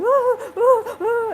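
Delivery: high, loud mock sobbing "ooh"; hammed-up childish blubbering wails; imitating kid blubbering. A kid crying in the bathroom mirror, mock sobbing. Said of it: "when you get really blubbery and you're like".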